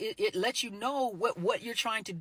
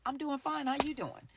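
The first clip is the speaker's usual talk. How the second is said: "I'm doing fine" higher and softer, a little hollow; guarded answer; 5 semitones higher, 4 dB quieter than usual. Shown as a demonstration. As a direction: answer politely while holding something back. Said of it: "how you answer a question".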